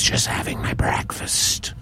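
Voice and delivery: breathy snake whisper, no voice at all; a sly snake hissing it under its breath. Acted. Snake answers the raven.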